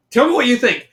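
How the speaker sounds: usual speaking voice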